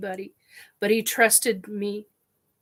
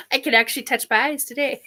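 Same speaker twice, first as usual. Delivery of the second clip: higher, quick, laugh-framed aside; 6 semitones higher, much faster than usual. Real, not acted. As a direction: laugh at yourself after tears. Laughs at herself after crying.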